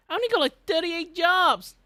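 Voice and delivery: very high, loud wail of protest; exasperated outburst. Acted. Artist complaining to viewers about job count, high loud mock outburst. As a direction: shriek the complaint, stretching the end.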